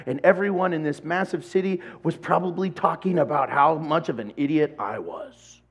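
ordinary talk

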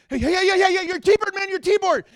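high, loud panicked shouting; frantic yell. Retold or imitated. Man yells at the stranger as his car rolls away, panicked shout. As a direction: shout after the rolling car in alarm. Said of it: "And the guy goes".

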